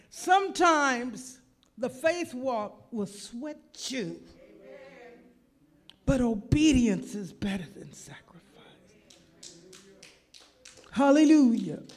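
high, loud, slow preaching burst. Acted. Preacher drives the point home to the congregation, slow and loud. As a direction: lean into it like a revival preacher.

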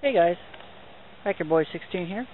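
normal talking voice

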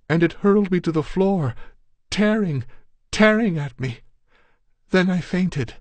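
put-on a shaken girl reliving the horror; terrified girl. A narrator's reading. Girl telling her brother of the attack, terrified and high.

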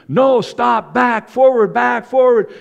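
high, loud overlapping commands; clamor of shouts. Retold or imitated. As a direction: yell directions over the crowd. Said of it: "this loud clamor of voices".

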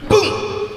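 a breathy, voiceless boom; a puff of air like a kick; soft imitated kick sound; whispered kick thud.

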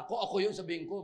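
ordinary delivery

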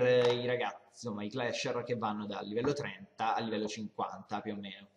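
ordinary talk

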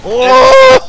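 high loud scream; screaming while falling. Real, not acted. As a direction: scream as you drop. Sees the bomb, falls.

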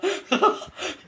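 high-pitched giggle; amused laughter. Real, not acted. Player amused by the absurd situation, high giggling.

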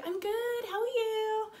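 very high, acted sing-song reply; mock starstruck squeak; gushing fan. Fan returns the star's greeting, high and gushing.

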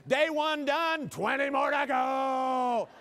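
high, loud, drawn-out mock announcement; hammed-up long-suffering yell; mock tweet shout. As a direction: holler it slowly, stretching the last word. Mock tweets about an endless conference.

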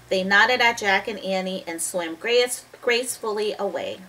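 ordinary delivery

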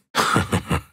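voiceless, pleased laugh; gloating chuckle. Acted. After taunting a Venom victim, gloating chuckle.

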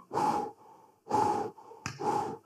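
voiceless puffs of breath; relieved puffing. Acted. Elijah after the fire stopped, puffing out soft breaths. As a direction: blow out three soft whews.

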